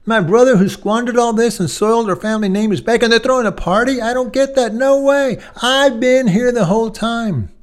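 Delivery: put-on bitter older brother's furious protest; older brother outraged at the party for his brother; incensed brother. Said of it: "he is incensed".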